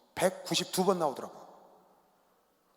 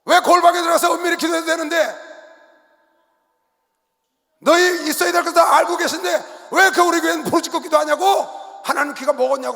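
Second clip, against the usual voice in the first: high, loud indignant questioning; complaining church member; 9 semitones higher, 10 dB louder than usual. Retold or imitated. Congregant complaining to the pastor about loud prayer, indignant. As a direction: voice the puzzled member's protest. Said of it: "성도들이 상담하는 겁니다, 저한테".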